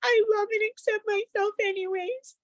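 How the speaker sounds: high, light child's voice imitating her ten-year-old; son's voice. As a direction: voice the little boy tapping sincerely. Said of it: "And he was like".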